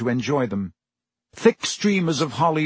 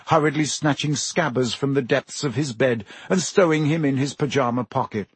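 usual speaking voice

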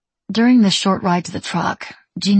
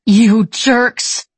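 loud, high, voice shaking with tears; wobbly tearful cry; 5 semitones higher, 7 dB louder than usual. A narrator's reading. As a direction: cry it out, voice breaking. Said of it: "she gasped in a wobbly voice".

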